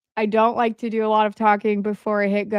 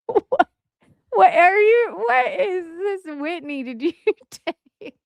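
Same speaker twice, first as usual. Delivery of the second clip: squealing, high, through laughter; laughing in disbelief; 7 semitones higher, slower than usual. Real, not acted. Reacting to Whitney's chat post, laughing helplessly. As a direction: laugh so hard the words crack.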